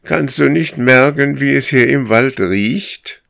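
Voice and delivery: loud, high pleading call; urgent loud question through the woods. Acted. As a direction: shout so he stops and notices. Moose calling through the forest.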